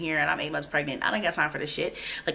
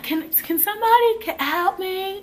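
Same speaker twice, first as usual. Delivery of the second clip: high, raised plea for help; retold calling out; 4 dB louder than usual. At the holding cell door, calling out for help. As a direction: call out high as if through a door.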